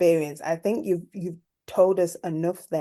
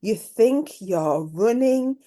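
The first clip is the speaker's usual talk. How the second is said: usual speaking voice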